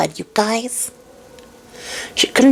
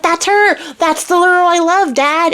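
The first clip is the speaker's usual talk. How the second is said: higher, bright excited blurt; excited prince; 8 semitones higher, slower than usual. Retold or imitated. Prince tells his father he found his love, excited. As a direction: gush it out like a thrilled lovesick prince. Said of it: "He's so excited".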